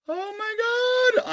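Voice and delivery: "Oh, my God" in a high, drawn-out squeal; excited squeal. Real, not acted. Collector thrilled by the cards, squeals high and slow. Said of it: "I'm getting so excited".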